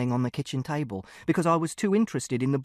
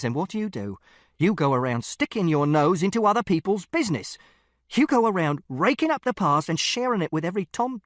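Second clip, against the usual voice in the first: angry tirade; 4 semitones higher, 4 dB louder than usual. Acted.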